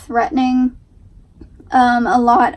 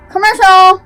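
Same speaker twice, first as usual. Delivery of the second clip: announcing; 7 semitones higher, 9 dB louder than usual. Real, not acted. Playfully announcing the break.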